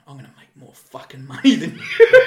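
loud laughing mock boast; loud mock brag. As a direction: boast it like a cocky apprentice. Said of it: "He goes".

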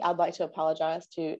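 usual speaking voice

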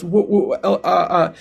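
imitating a nervous stutter. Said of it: "he starts stuttering and he goes".

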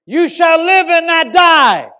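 full-force high shout, each word drawn out; slow, booming declaration at the top of the voice; shouted command. Retold or imitated. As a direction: bellow it like a preacher commanding life. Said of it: "I was leaning against the wall, and I shouted".

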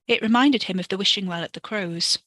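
ordinary delivery, nothing marked

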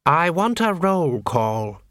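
slow, clipped command; a slow, stern guild head's demand; snapped order. A narrator's reading. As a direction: bite off the order. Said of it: "he snapped".

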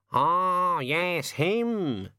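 high, squawky bird voice; drawn-out knowing raven reply; raven recognising someone. A narrator's reading. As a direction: let it dawn in a high bird voice. Raven recognises who is meant.

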